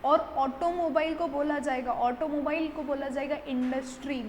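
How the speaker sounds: ordinary delivery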